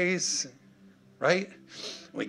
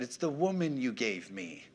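low, soft blame-shifting; excuse-making; 8 semitones lower, 8 dB quieter than usual. Acted. Preacher voices Adam blaming Eve, excuse-making. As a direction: quietly pass the blame along. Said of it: "Adam said".

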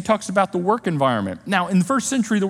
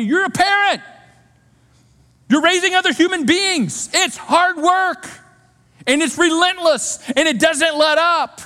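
emphatic preaching; 9 semitones higher, 3 dB louder than usual. Real, not acted. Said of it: "preaching emphatically".

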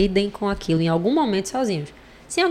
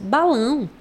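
higher, puzzled little pupil voice; mock puzzled kid; 5 semitones higher, much slower than usual. Pupil laughing at the teacher's accent, mock puzzled question. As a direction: lightly play a kid baffled by the word. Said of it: "eles morrem de rir porque ficam".

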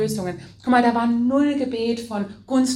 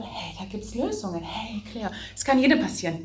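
much softer, calm reassuring voice; gentle comforting husband; 6 dB quieter than usual. Retold or imitated. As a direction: soothe her like a patient husband. Husband Matthias comforting her.

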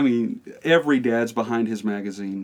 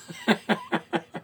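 high laugh; 12 semitones higher than usual. Real, not acted. Laughing at dad's reply.

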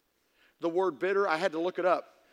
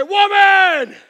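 loud, higher yell of Woman!; mock husband yell; 8 semitones higher, 13 dB louder than usual. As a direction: bellow it like a bossy husband calling his wife. Husband barking at wife.

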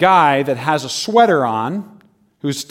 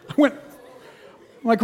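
usual speaking voice

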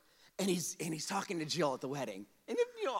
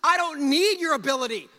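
loud, high, emphatic preaching voice; God's declaration; 9 semitones higher, 10 dB louder than usual. Acted. God corrects Moses about his ability, forceful declaration. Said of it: "God is saying".

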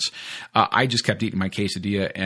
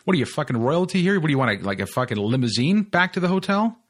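higher, mocking rant at himself; mock self scolding; 7 semitones higher than usual. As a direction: sneer at your own snobbery. Scolding himself in his head.

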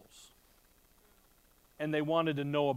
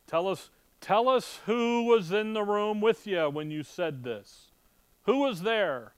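demanding; 5 semitones higher, 5 dB louder than usual. Acted. Voicing interrogators demanding answers.

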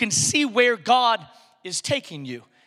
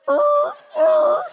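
high, loud stuttering uh uh uh; hammed-up preacher stammer; mock startled stammer; 6 dB louder, slower than usual. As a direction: sputter in comic alarm. Jesus stammering at roof falling.

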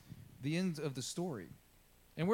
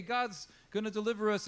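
higher, eager imitation of the hopeful people; a touch of hammed-up joy; mock cheering; 6 semitones higher than usual. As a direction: lift it with naive excitement. People cheering God's deliverance.

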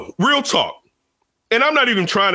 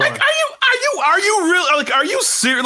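can't believe what you're hearing; incredulous; 12 semitones higher than usual. Real, not acted. Host asking the caller if he's for real, high disbelief.

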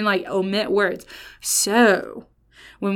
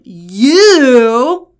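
"you" blasted out much louder and higher; heavy emphasis on you; 4 semitones higher, 11 dB louder than usual. Shown as a demonstration. Preacher reads John with his intended emphasis, heavy stress on you. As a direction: stretch "you" loud and long, pitch lifted. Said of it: "the emphasis that I think".

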